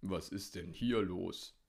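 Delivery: lightly voiced bewildered old hunter; baffled hunter. A narrator's reading. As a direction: ask it as the puzzled hunter. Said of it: "fragte er und schaute verdattert". (verdatterter Jäger)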